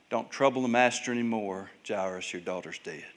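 low, hushed messenger breaking grim news; quietly solemn, sinking at the end; subdued bad news. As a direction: drop soft and low to deliver a death notice. Messenger brings news of death.

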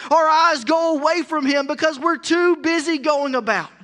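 loud, rising sermon build to a shout; preaching at full force. Real, not acted. Pastor preaching to the congregation, loud and urgent. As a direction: pastor driving it home to the congregation.

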